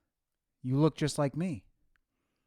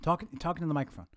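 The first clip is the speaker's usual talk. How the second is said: ordinary talk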